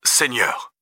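lightly voiced dismay; shocked father. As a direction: react to bad news under the breath. Father reacts to bad news.

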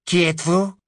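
high, icy questioning woman; cold woman's voice. Acted. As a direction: demand a name coldly.

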